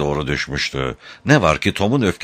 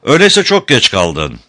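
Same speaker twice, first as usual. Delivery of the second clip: raised, louder voice with devilish relish; gloating; 6 semitones higher, 6 dB louder than usual. A narrator's reading. El Avuf taunts Tom about his missing brother, gloating. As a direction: relish the enemy's loss, pitched up and forceful. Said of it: "şeytanca bir zevk duyarak".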